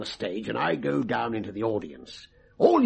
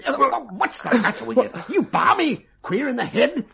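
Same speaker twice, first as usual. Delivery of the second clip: loud, high calls to wake him; rousing shout; 10 semitones higher, 4 dB louder than usual. Acted. Mind reader rouses his stooge from a trance, loud and high.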